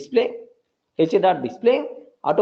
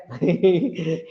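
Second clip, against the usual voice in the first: short amused laugh; brief real chuckle mid-explanation. Real, not acted. Teacher amused while repeating head to the class, chuckle.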